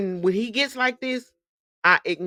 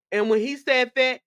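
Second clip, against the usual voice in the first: ordinary delivery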